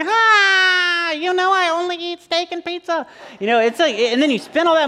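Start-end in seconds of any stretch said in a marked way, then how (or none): 0.0-3.0 put-on whiny kid. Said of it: "they're like".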